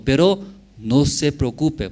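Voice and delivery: ordinary delivery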